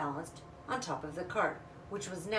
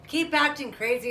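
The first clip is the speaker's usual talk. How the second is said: higher, louder taunt; teasing brother; 7 semitones higher, 7 dB louder than usual. Acted. Older brother teasing his wild little sister. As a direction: needle your little sister.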